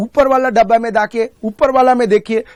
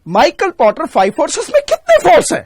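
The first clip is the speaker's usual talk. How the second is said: high, loud mock quizzing; raised voice, ridiculing an obvious question; mock exam question; 9 semitones higher, 5 dB louder than usual. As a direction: pose the silly question with exaggerated force, pitched right up. Mocking an absurdly obvious exam question.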